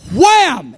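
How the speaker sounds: high, punched "wham"; exclaimed impact. Retold or imitated. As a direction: act out a sudden jolt hitting you. Sudden hit of power, acted out.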